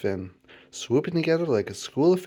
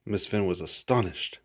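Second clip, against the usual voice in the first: ordinary delivery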